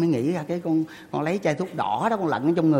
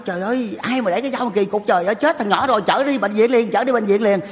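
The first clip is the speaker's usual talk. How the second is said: higher, louder frightened mother's cry; a mother crying out in alarm; panicked mother; 5 semitones higher, 5 dB louder than usual. Retold or imitated. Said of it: "má con nói". (mẹ hoảng hốt la lên)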